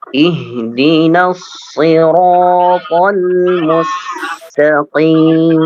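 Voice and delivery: slow chanted recitation of Iyyaka na'budu; Quran recitation. Shown as a demonstration. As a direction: recite slowly and melodically. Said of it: "teacher models recitation".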